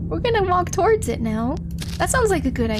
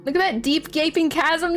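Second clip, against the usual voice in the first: ordinary talk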